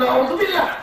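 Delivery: very high, squeaky put-on voice; mock pious exclamation. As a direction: parody a timid husband's prayer. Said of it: "Kau bilang".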